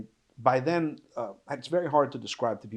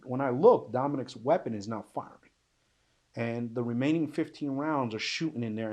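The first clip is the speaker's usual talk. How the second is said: normal talking voice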